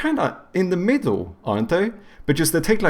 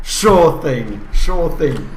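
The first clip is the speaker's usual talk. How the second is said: sarcastic; 4 semitones higher, 6 dB louder, much slower than usual. Real, not acted. Sarcastic reply to cheating enemy.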